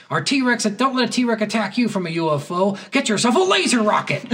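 high, hammed-up ad spiel; mock commercial pitch. Retelling a comedian's laser rocket commercial, mock ad pitch. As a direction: sell it like a ridiculous TV spot.

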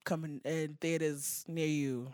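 usual speaking voice

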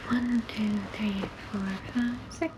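counting softly under the breath; hushed count of the prompts; whispered counting. Real, not acted. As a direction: tick them off in a whisper. Counting options under her breath.

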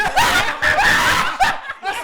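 high, loud shrieking laugh; a falsetto laugh-scream; howling laugh at the Shein joke. Real, not acted. Cracks up over Shein.

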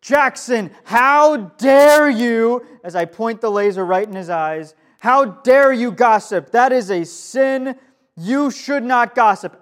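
loud, high, slow finger-wagging rebuke; hammed-up self-righteous accusation, drawn out; mock outraged scolding. Pastor playing a self-righteous accuser calling out gossiping kid, mock outrage. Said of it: "I'm just like".

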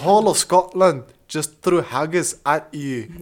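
hammed-up Scottish indignation; Scottish accent. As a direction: put on a big Scottish accent. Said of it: "That was pretty good".

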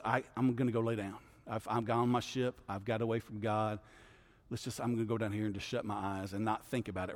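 low, soft, defeated mumble; a worn-out prophet hiding below deck, low and soft; depressed sigh. Retold or imitated. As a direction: give up and tune it all out, quietly. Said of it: "kind of depressed prophet".

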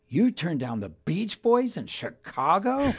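put-on a judge astonished at the kid, slow and laughing; incredulous judge. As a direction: stretch out the disbelief, half laughing. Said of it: "this was her line of the night".

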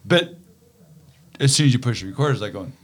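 usual speaking voice

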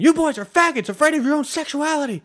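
high, loud screaming insult; imitated scream. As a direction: yell it like a cyclist shouting as he passes. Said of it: "screamed from his bike".

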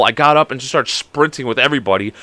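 ordinary delivery, nothing marked

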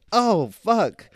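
loud, high cry of sudden realization; Homer Simpson moment. Retold or imitated. Retelling a failed No Sugar November, mock-dismayed outburst. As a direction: exclaim it like you just blew it. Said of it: "That's a Homer Simpson style".

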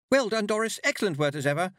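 high, fluty trill of congratulation; gushing high-pitched praise. A narrator's reading. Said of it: "she trilled".

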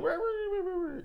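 high meowing rah rah; squeaky cat chatter. Retold or imitated. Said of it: "he'll talk in his cat voice".

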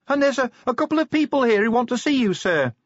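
high, tinny and worried; anxious voice down the tube. Acted. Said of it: "She sounded worried".

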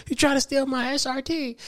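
high, quiet woman's voice. Retold or imitated. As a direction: ask it like an upset woman. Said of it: "you hear the bitch say".

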